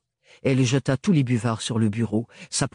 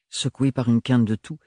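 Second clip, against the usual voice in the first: plain reading voice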